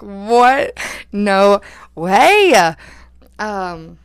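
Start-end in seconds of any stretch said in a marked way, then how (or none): 0.0-2.7 shocked disbelief. Retold or imitated. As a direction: blurt it out loud, hammed-up disbelief. Retold shocked reaction to date.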